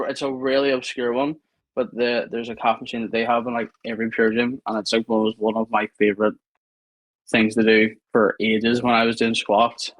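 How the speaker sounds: usual speaking voice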